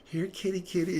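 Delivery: high, sweet here-kitty call; sing-song kitty kitty coax. Retold or imitated. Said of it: "You're like".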